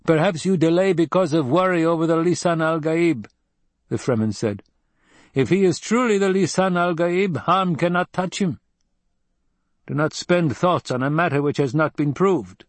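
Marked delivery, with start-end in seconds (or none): 0.0-3.3 high-pitched, matter-of-fact tribesman voice; higher voice, blunt conviction; Fremen desert fighter, blunt. A narrator's reading. 5.3-12.6 high-pitched, matter-of-fact tribesman voice; higher voice, blunt conviction; Fremen desert fighter, blunt. A narrator's reading.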